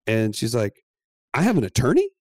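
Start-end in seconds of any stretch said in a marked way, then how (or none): 1.3-2.1 high, louder incredulous question; astonished wife. Retold or imitated. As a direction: imitate her amazed realization, rising at the end. Retold wife learns of attorney.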